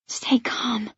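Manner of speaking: soft urgent whisper; inner voice whisper. A narrator's reading. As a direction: whisper it to calm yourself. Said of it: "my inner voice urges".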